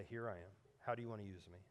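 soft, low, surrendered; hushed, low-pitched willingness; humble submission. Acted. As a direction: offer yourself quietly, as in prayer. Models humble submission to God.

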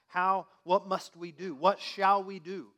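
loud, slow pleading question; a crowd asking what to do; crowd's plea. Acted. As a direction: cry out like the crowd. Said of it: "like the people in the crowd".

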